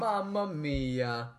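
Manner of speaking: high, loud, long-drawn catchphrase; mock Italian plumber. Imitating a video game plumber's dismay, cartoonish.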